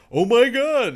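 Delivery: high, loud mock outrage. Mocking people reacting to an article, loud mock outrage. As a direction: exclaim it like an outraged reader. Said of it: "everyone's fucking like".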